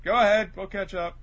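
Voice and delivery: imitated reassuring shout; calling up. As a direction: holler up to the others. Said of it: "Kinemon calls up".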